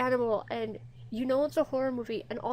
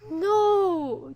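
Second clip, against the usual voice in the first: loud, high wail of protest; distressed cry; 6 semitones higher, 8 dB louder than usual. Real, not acted. Horrified at the dead cat.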